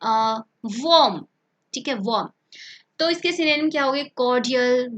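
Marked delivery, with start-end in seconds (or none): 0.0-2.3 वार्म said slowly as "wom", no r; teacher models वार्म as "wom"; silent r. Shown as a demonstration. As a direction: stretch out वार्म with the r dropped. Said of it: "इसको बोलोगे".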